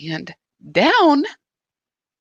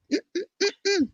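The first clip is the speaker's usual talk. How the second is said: high laugh; 12 semitones higher, 4 dB quieter than usual. Real, not acted. Laughing at her own outburst.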